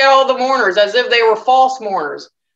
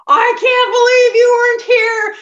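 much higher, louder tearful wail; Mary's high, louder tearful cry at the grave; imitating crying Mary; 14 semitones higher, 5 dB louder than usual. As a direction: voice Mary breaking into tears. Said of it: "And she's crying".

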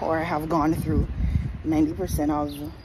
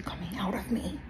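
whispering; 4 semitones higher, 4 dB quieter than usual. Real, not acted. Vlogging at the appointment.

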